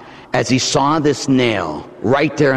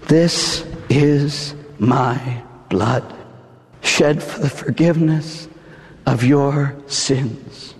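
solemn words of Jesus; 3 semitones lower, slower than usual. Acted. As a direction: draw it out gently, like a priest at the altar. Voicing Jesus consecration words.

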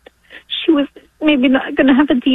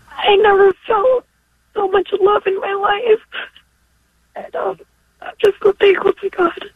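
crying; 5 semitones higher than usual. Real, not acted. Caller crying over daughter's birth.

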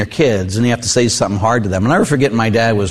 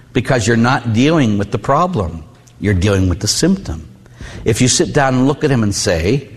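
normal talking voice

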